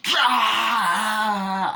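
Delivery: long, high, loud scream of pain; agonized scream. Retold or imitated. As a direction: imitate screaming in agony getting out of bed. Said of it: "the screams of fucking agony that he has".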